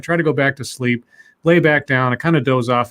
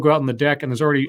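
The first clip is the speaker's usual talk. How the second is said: usual speaking voice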